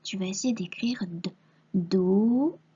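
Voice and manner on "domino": "domino" drawn out as do-mi-no; dictated syllables. Shown as a demonstration. Teacher dictating a word to write, slow syllables. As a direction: stretch each vowel of "domino". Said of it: "il faut accrocher toutes ces syllabes".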